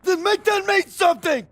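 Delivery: high, loud, furious shout; angry shout. Acted. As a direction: yell it back in his face. Heated argument between soldiers.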